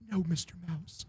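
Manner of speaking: soft, meek reply. Acted. Cowed band member answers [name], soft meek voice. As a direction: answer meekly, under your voice. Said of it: "the fucking Jonas Brothers say something".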